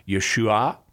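"Yeshua" said Aramaic-style, ye-SHOO-ah; Aramaic pronunciation. Shown as a demonstration. Apologist showing a caller the Aramaic name, Aramaic pronunciation. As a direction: say "Yeshua" as ye-shoo-AH, rising at the end. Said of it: "would have been pronounced something like".

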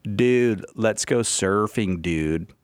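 put-on slow Californian surfer American accent, sinking at the end; slow, drawn-out American surfer-dude accent; mock American accent. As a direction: imitate Australians doing an American surfer accent. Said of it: "This is their American accent".

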